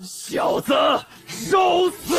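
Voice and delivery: 凶狠喊杀；怒吼着扑上去；一声受死喊出杀气；凶狠怒喝. Acted. 敌人扑杀喊叫.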